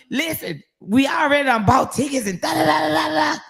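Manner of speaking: high-pitched retold fussing; mad retort. Retelling how she argued with Juice about tickets, mad. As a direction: re-enact arguing back, running into high chatter. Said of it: "mad as hell".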